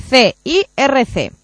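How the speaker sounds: slow, letter by letter spelling; over enunciating. Real, not acted. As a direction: spell it out slowly. Deletrea a oyente que oye mal. (deletreo lento)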